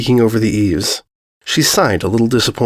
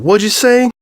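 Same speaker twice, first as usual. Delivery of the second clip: higher pitched call across the street; 10 semitones higher than usual. Acted. Applejack calling back from halfway home, raised high call. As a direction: lift the voice to reach someone far off. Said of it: "she called".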